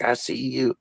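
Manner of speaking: hushed nun quote. Retold or imitated. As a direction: say it low and soft, like the nun. Nun calls out the demon.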